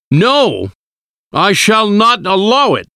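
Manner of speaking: high-pitched, forceful refusal; angry shout. A narrator's reading. Husband forbidding the professor's plan, shouting high and angry. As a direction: cry out in outraged protest. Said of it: "he shouted".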